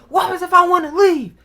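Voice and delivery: high, loud challenge; defiant shout. Retold or imitated. Teen talking back to raging mom, loud high defiance. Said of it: "she was going off at me".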